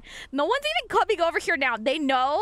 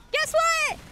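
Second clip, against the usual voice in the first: high silly voice; 11 semitones higher than usual. Pretend. Playground joke, high.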